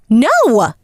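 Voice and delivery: sharp high shouted no; shouting. Acted. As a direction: shout it, refusing to go. She throws glass, refusing.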